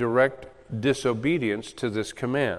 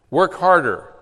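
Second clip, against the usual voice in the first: a hint of a pushy preacher, louder and higher; mock demand; 5 semitones higher, 7 dB louder than usual. Preacher mocking a demand on the congregation, mock stern. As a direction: play the nagging taskmaster lightly. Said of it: "the impression that you all have to go home and do more".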